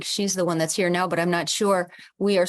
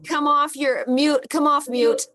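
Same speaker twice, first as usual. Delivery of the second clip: high, louder, eager call; 9 semitones higher, 3 dB louder than usual. Real, not acted. Host urging everyone to unmute, calling out.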